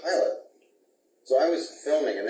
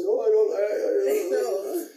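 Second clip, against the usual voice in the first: mock crying; 9 semitones higher, 3 dB louder than usual. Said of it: "I'm practically crying".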